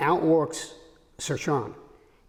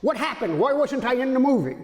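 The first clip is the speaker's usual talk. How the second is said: very bad Scottish accent, pitched higher; a bad Scottish accent imitating Sean Connery, higher and hammy; 7 semitones higher than usual. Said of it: "I'm gonna do a very bad Scottish accent".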